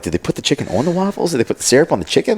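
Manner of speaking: high, bewildered questions tumbling out; retelling total confusion at the menu; baffled surprise. Retold or imitated. A friend retelling his first chicken and waffles, baffled.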